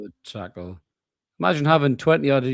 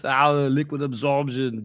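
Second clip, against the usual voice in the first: slow, heavy action-hero voice; pumped-up movie muscleman selling a product; Arnold Schwarzenegger; 2 semitones higher, slower than usual. Retold or imitated. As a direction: savour the product name like a muscleman ad. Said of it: "You can imagine Arnold Schwarzenegger popping up with that advert".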